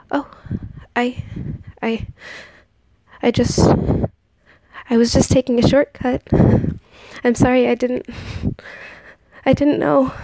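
breathless, tripping over words; frightened stammer. A narrator's reading. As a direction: stammer it out in panic. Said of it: "The princess stammered".

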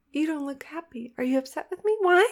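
usual speaking voice

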